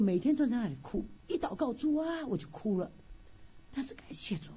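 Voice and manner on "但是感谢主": barely audible whisper of thanks. Real, not acted. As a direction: breathe the words out, no voice. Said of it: "unvoiced whisper".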